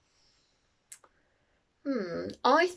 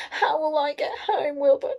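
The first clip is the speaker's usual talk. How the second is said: raised, higher-pitched storybook distress; worried cry; 7 semitones higher, 5 dB louder than usual. A narrator's reading. Winnie stranded without her broomstick, crying out. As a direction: cry out to the cat for help. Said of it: "cried Winnie".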